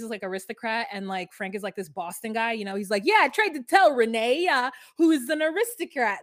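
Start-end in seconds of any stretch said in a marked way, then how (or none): 3.0-6.2 louder, higher mock East Coast guy accent; playful made-up New Jersey Italian accent. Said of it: "That's New Jersey or Italian or whatever".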